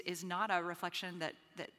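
ordinary delivery

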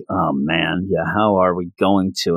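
deep, sheepish realization; lightly voiced gruff Taskmaster; low sheepish Taskmaster. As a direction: drop low like a caught-out tough guy. Taskmaster caught without a plan.

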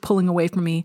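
ordinary delivery, nothing marked

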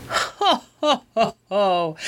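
hammed-up giant guffaw; roaring giant laughter. A narrator's reading. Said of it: "roared the giant, in a fit of immense laughter".

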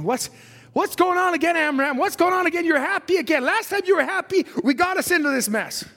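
fretting wife asking what's up; worried wife. Acted. [name]'s worried voice in story.

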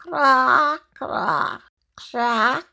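cawing like a crow. Acted. The crow caws at Elle, cawing. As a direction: caw it out like the crow.

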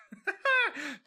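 a sudden high laugh that breaks out; can't hold back a giggle at her; high squeal of a laugh; burst of laughter. Real, not acted. Amused at Kate blurting.

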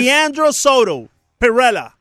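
put-on "[name]" slowly in a thick American accent; teacher's American accent. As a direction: imitate an American teacher mangling the Spanish name. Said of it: "las maestras me decían".

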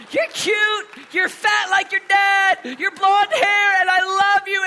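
high, loud, slow doting praise; doting shout. Retold or imitated. Dad gushing over his little girl, high and loud. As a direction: gush over her at full volume. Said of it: "I picked her back up".

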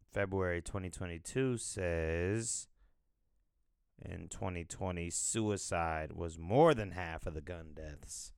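usual speaking voice

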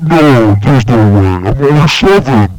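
usual speaking voice

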